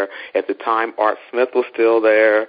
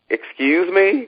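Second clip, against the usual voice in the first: high, rising incredulous question; retold affronted double-take, pitched up; indignant disbelief; 9 semitones higher, much slower than usual. Caller told to stay across the street, indignant.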